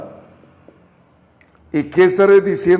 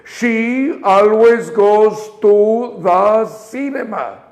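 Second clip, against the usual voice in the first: "always" drawn out slowly, then the rest in an even rhythm; rhythm and intonation; slower than usual. Shown as a demonstration. Teacher models the sentence for the class, slow and rhythmic. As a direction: sentence shaped with breath and attitude, not shouted. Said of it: "Otra vez, uno, dos, tres".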